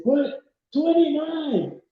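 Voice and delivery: can't believe the number; retold stunned question; surprised disbelief. Stunned by the mile count.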